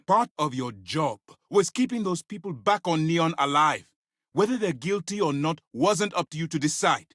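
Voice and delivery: high-pitched, indignant reproach; angry reprimand. Acted. Commander blaming someone for deaths on Neon, indignant and high. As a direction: scold with the pitch pushed up. Acted reprimand over lost lives.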